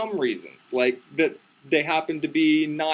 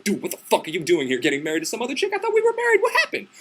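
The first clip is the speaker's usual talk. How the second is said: indignant rant climbing to a high squeal; real mad, retold; 6 semitones higher, faster than usual. Bride confronting the hero, escalating mock outrage. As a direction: start incredulous, end in a squeaky protest. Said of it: "And she gets real mad".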